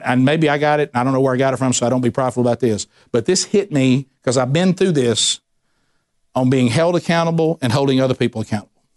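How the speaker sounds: ordinary delivery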